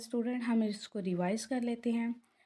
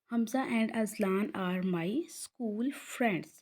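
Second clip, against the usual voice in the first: very slow, careful English sentence; slow model reading; much slower than usual. Shown as a demonstration. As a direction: read it out word by word for the class. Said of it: "यहाँ पे आप देख सकते हैं". (धीमे-धीमे साफ़ पढ़ते हुए)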